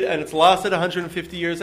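usual speaking voice

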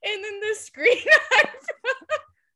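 high, broken by laughter; can't get the word out for laughing; real laughter cutting the word off. Real, not acted. Laughing retelling the freeze.

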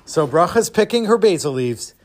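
ordinary delivery, nothing marked

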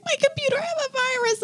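high, soft imitation of crying; mock tearful. Daughter retells grandma's upset scam call, mock tearful. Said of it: "cry-, in tears".

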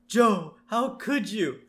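a hint of a betrayed wife; mock yell. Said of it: "she yells at him".

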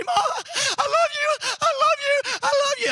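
put-on very high, slow wailing child voice, with a laugh; scared little girl. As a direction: drag it out like a child's last goodbye. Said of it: "she would look at Mom and say".

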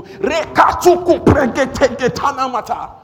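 high, drawn-out shouted tongues; explosive tongues prayer. Shown as a demonstration. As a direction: erupt into full-force prayer. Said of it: "when you just go".

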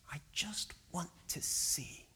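hushed, nearly voiceless longing; whispered plea. Acted. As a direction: whisper a heartfelt wish. Preacher voicing the blind man's plea.

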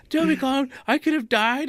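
high, loud mock wail of a hysterical coworker. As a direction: ham up her over-the-top panic. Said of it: "screaming at you".

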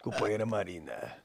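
sly low Lula asking; Lula impression. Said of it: "imitar o Lula".

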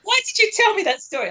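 high, incredulous outburst; half-laughing disbelief at his friend. Real, not acted. Friend asks why he told that story, in high disbelief. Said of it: "What a weird sentence".

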